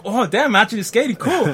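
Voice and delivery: loud, thrilled self-quote; proud of finally skating; loud excited inner voice. Retold or imitated.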